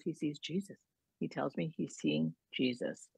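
hushed wife telling a sacred secret; quiet awe. Retold or imitated. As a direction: say it gently like a quiet wonder. Elderly wife answering calmly.

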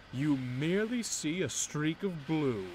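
high, slow bragging with stress on merely; boastful. Acted. Loaned engine boasting to Edward about his speed. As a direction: stretch out merely proudly. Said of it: "When I pull coaches".

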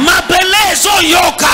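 very high, loud proclamation; climactic preaching shout. Real, not acted. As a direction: shout it out over the church.

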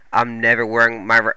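ordinary talk

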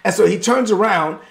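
usual speaking voice

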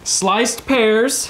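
loud, sharp call of the label. Real, not acted. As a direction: bark it out loudly. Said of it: "Why are you yelling?".

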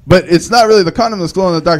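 usual speaking voice

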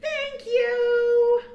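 high, loud, drawn-out thank you. Acted. Hamster thanking friends for birthday presents, high and loud. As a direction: belt a grateful thank you.

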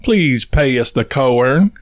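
slow Southern drawl, vowels stretched into two syllables. Shown as a demonstration. Husband demonstrates Southern speech to his wife, slow Southern drawl. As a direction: draw out PAY US and CORN like a Southerner. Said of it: "'Please pass the corn' in Southern".

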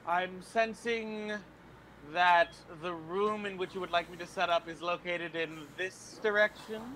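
lightly hammed-up seer, lingering on the first words; psychic act. Fortune teller divining the room for her host, hammed-up psychic act.

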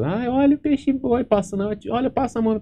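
put-on very high, squealing kid voice; excited children. Children at the beach urging him to touch the manatee, high excited squeal. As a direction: squeal like thrilled little kids.

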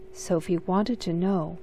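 ordinary delivery, nothing marked

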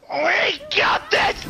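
high, slow, cracking yell of triumph; excited shout. Real, not acted. Streamer cheers a clutch play, high cracking shout. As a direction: scream it like you just won.

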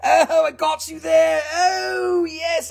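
high, whooping delight; real high-pitched triumphant glee; gleeful gotcha. Real, not acted. Gleeful after fooling a viewer.

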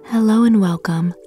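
ordinary delivery

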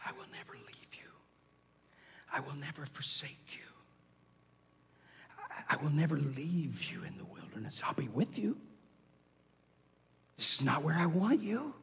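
soft, hushed reassurance; whispered voice of God. Acted. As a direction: whisper a promise tenderly. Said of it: "He whispers to draw you close".

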